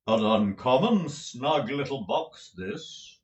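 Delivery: slow, theatrical actor's voice; pompous tragedian. A narrator's reading. Lenville admiring Nicholas's room, slow and grand. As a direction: roll it out like a stage tragedian.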